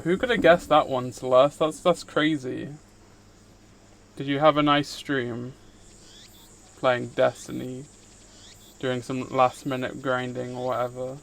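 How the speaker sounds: ordinary talk, nothing marked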